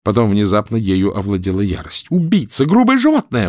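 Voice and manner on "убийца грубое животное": very high, loud screech of insults; woman screeching at Captain Blood; shrieking. A narrator's reading. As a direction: shriek at the killer in rage. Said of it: "взвизгнула она".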